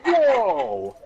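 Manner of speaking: high, loud laughing whoa; loud startled whoa, half laughing; whoa of relief. Acted. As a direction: gasp it out with a laugh. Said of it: "Then I said".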